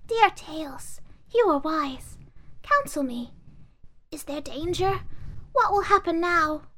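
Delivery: put-on high girlish pleading voice; doll voice. Doll speaks.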